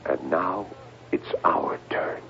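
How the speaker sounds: low, slow whisper of doom; hushed, slow whisper of the end. Acted. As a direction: breathe it like a dark secret. Ominous prophecy to the doctor.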